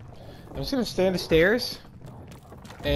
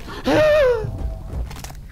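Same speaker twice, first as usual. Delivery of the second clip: long high-pitched gasp of fright; sharp frightened gasp; startled gasp. Real, not acted. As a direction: suck in air with a high squeal. Startled in the dark.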